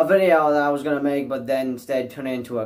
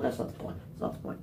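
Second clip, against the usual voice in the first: muttered aside; 3 semitones lower, 15 dB quieter, faster than usual. Real, not acted. Self-correcting mid-ramble.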